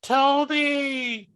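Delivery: high mock-cheering voice; sarcastic high-pitched fan cheers; mocking yells. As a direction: tease him with fake excitement. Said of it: "people are just making fun of him".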